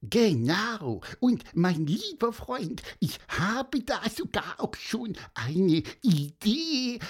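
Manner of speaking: cheerful little creature, pitched high; eager bird savouring the thought of food; high squeaky bird voice. Acted. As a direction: chirp a happy idea with relish. Bird Pieper proposes fries, enthusiastic.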